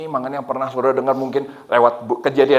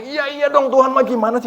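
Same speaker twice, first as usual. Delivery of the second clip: high-pitched exasperated comeback; mock-annoyed protest, pitched way up; retold anger at God; 13 semitones higher, 3 dB louder than usual. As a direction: act out an indignant complaint. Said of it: "Jadi saya marah".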